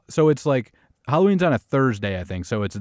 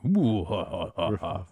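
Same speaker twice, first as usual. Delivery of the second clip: spooky scary voice; 3 semitones lower, 3 dB quieter, slower than usual. Shown as a demonstration.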